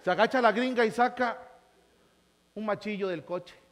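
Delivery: normal talking voice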